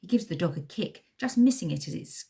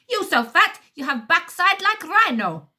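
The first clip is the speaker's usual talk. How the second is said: high loud mocking insult, heavy accent; 12 semitones higher, 9 dB louder than usual. A narrator's reading. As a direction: shout the insult, thick accent. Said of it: "shouts the second man".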